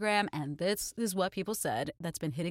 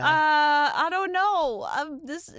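flustered; 6 semitones higher, 6 dB louder, slower than usual. Retold or imitated. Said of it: "'Cause I was like".

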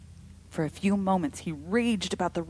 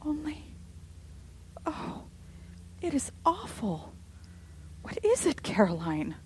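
high, crying out in terror; terrified; 10 semitones higher than usual. A narrator's reading. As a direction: plead in rising fear. Rebecca in terror.

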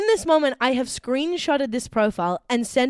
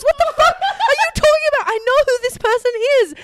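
high, loud, laughing retort; outraged laughing shout at the catfisher; angry shout; 13 semitones higher, 5 dB louder than usual. Retold or imitated. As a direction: yell it back at him, half laughing in disbelief. Angrily telling off catfisher.

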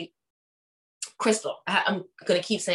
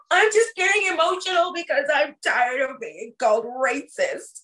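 higher, louder hammed-up weepy voice; mock tearful; 7 semitones higher, 5 dB louder than usual. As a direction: fake the crying as she pleads. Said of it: "she was like".

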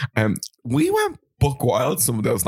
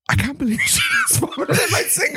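high squeal of disbelief. Retold or imitated. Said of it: "and then I went".

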